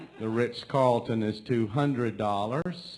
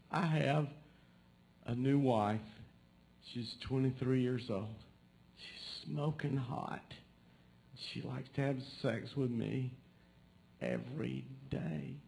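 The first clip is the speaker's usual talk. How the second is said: put-on halting, tearful old man act; mock distraught; 2 semitones higher, 6 dB quieter than usual. Distraught old man confiding in a stranger, mock crying. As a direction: act upset, with long pauses between phrases. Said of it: "and he's crying".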